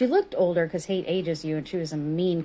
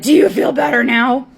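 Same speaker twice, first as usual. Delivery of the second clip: higher, louder snide question; the woman taunting the narrator as she leaves; mock sneering Karen; 6 semitones higher, 10 dB louder than usual. Karen sneering before leaving.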